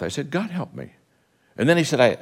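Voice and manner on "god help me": a soft muttered plea under the breath; half-voiced murmured prayer. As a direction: pray it quietly to yourself. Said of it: "under my breath".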